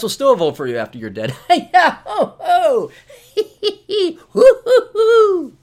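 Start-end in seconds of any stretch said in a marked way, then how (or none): 1.5-5.6 high, loud hooting laugh; hammed-up laughing in rising hoots; mocking laughter. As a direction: whoop with exaggerated glee. Mock laughing after the boomer bit.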